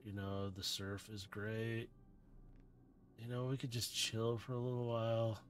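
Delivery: lazy drugged beach bum; drowsy surfer dude. Acted. GM voices drugged surfer Drift.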